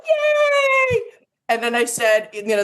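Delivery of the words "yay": very high squealed yay; answering the crew's cheer, shrieking with joy; excited squeal. Retold or imitated. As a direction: squeal back with glee. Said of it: "And I'm like".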